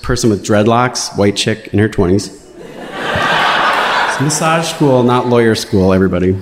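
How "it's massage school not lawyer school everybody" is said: laughing it out, drawn out slowly. Real, not acted. Telling the audience about massage school while laughing. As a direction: chuckle through the words slowly.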